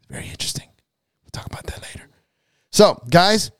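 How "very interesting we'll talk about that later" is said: hushed secret whisper; whispered aside. Real, not acted. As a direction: drop to a soft whisper.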